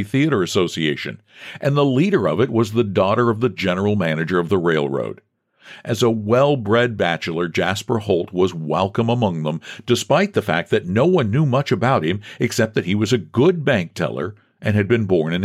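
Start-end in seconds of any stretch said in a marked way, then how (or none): none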